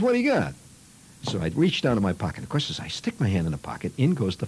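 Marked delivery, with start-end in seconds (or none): 0.0-0.5 put-on high, pushy boy's question; eager kid. Curious kid demanding to see the box, high and eager. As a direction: pester like a curious kid, pitched up. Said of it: "Flick says".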